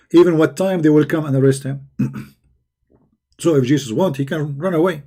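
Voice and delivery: ordinary delivery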